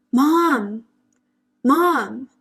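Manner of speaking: retold brother yelling through the bedroom door; yelling for mom. Brother yelling for mom at her bedroom door, loud and urgent. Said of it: "and he's yelling".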